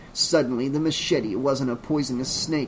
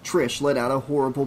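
usual speaking voice

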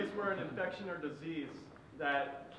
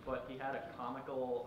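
usual speaking voice

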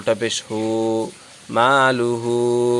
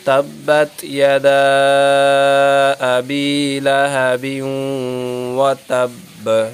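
recitation; 2 semitones higher, 3 dB louder than usual. Shown as a demonstration. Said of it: "teacher recites correctly after correction".

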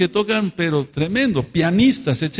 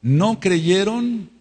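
slow emphasis; 8 semitones higher, much slower than usual. Real, not acted. Preacher stresses key phrase.

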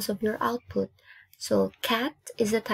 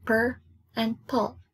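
PER and PUL as two separate parts; syllable by syllable. Shown as a demonstration. Teacher naming the two parts, syllable by syllable. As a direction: set "Per" and "pul" apart with pauses. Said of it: "two syllables".

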